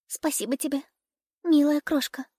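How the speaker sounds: high, weak chirping bird voice; weak little bird. A narrator's reading. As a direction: thank her like a frail sick bird. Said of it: "чирикнула больная ласточка". (слабый птичий голосок)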